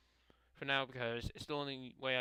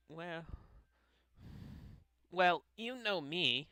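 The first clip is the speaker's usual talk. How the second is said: higher put-on anime-girl voice; Osana; 5 semitones higher than usual. Club girl answering praise for baking, raised character voice. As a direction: pitch it up for a game-girl character. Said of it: "Osana's voice".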